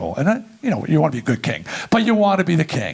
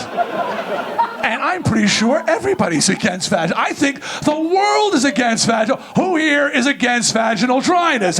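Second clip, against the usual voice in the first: rallying shout; 7 semitones higher than usual. Real, not acted. Speaker to the crowd, polling them in a laughing shout. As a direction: whip up the audience with a laughing shout.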